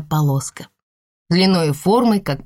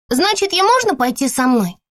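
put-on high hopeful little girl; eager little girl asking; child voice; 9 semitones higher than usual. A narrator's reading. Child asks the mother. (детский голос с надеждой)

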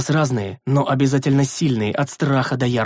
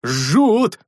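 pained cry; 5 semitones higher, 4 dB louder than usual. A narrator's reading. Hands burning, in snow.

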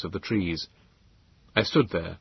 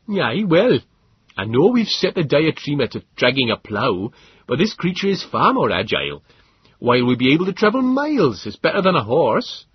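put-on higher, louder cheerful rustic young man; proud colonist boasting of his mount; Stubbins voice; 7 semitones higher, 6 dB louder than usual. A narrator's reading.

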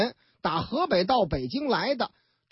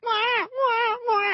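put-on high, slow wailing baby cry; 11 semitones higher, much slower than usual. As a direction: imitate a newborn bawling. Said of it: "这孩子哭啊". (模仿婴儿哇哇大哭)

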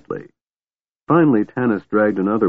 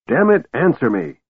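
higher-pitched angry outburst; raving; 6 semitones higher than usual. A narrator's reading. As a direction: demand it, losing your temper. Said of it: "Tanis raved".

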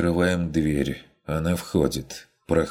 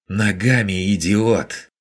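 louder, higher angry snap at the innkeeper; hoarse shriek; 5 semitones higher, 5 dB louder than usual. A narrator's reading. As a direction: break into a furious yell. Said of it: "Сорвавшись на хриплый визг". (срывающийся крик в ярости, громче и выше)

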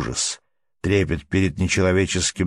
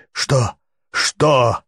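high, louder "what? what?"; urgent demand; 6 semitones higher, 4 dB louder than usual. Acted. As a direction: shake an answer out of him. Gripping shoulders, demanding.

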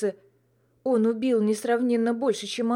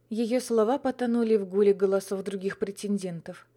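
plain reading voice

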